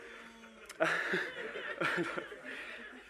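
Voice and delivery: laugh. Real, not acted. Laughs at own joke.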